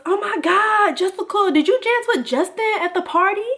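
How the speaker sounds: high, breathless-with-news friend impression; urgent friend. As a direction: imitate a friend blurting a juicy question. Friend confronts her, acted out.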